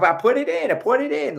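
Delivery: high-pitched imitation of a grumpy Boston local; Boston accent. Mimicking a guy at CVS arguing with a woman, Boston accent. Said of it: "he was talking with his Boston accent".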